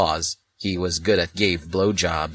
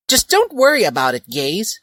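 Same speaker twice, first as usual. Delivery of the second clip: annoyed brush-off; 12 semitones higher, 4 dB louder than usual. Acted. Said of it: "She started to become annoyed".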